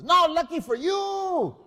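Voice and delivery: put-on swaggering Falstaff-style braggart bellowing at the kids; Fausto waking up. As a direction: roar it out like a drunken thief king. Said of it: "Fausto says".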